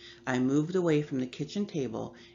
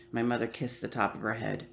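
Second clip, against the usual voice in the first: usual speaking voice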